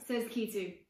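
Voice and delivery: ordinary delivery, nothing marked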